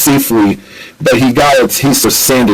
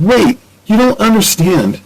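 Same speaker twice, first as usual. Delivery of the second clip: pleading; 12 semitones higher than usual. Acted. Nicole pleads as Ratchet leaves.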